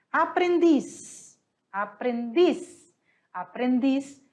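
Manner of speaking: "aprendiz" said slowly, stress on the final dis; tonic syllable demo. Shown as a demonstration. Teacher repeating "aprendiz" to show the stressed syllable. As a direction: stretch out "aprendiz", landing on dis. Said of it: "a sílaba tônica tá em dis".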